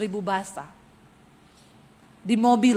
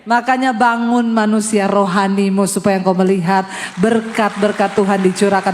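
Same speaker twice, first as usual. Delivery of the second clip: louder, full-force preacher's close; rally the congregation to stand; a loud closing exhortation; preaching call; 2 semitones lower, 7 dB louder than usual. Acted.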